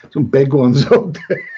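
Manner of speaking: real laugh breaking into the words. Real, not acted. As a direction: let a cheeky chuckle slip out mid-phrase. Cheeky remark, laughs while saying it.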